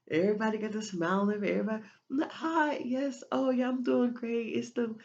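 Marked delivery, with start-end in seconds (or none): 2.1-5.0 mock fake cheer. Mocks fake social greeting.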